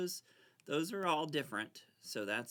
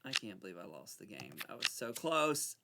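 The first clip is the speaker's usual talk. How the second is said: normal talking voice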